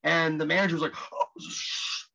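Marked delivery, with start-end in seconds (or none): 1.1-2.0 an embarrassed half-whispered sh, caught off guard; hushed shush. Retold or imitated. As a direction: hush the word quickly, almost breathless. Manager hushing an awkward remark.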